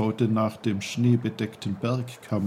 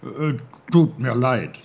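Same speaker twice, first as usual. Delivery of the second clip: a hint of an old uncle, a bit louder; lightly voiced old uncle; 3 semitones higher, 6 dB louder than usual. Uncle Pfefferkorn reassures the snowdrop, lightly voiced.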